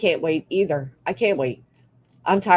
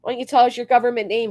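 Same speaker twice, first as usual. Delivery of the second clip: usual speaking voice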